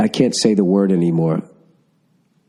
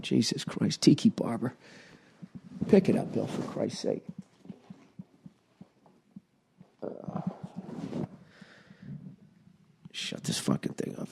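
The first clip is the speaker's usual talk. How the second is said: much softer, fading to a near whisper; muttering to himself; 14 dB quieter than usual. Real, not acted. Fumbling the dropped phone, muttering softly to himself. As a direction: grumble it under your breath.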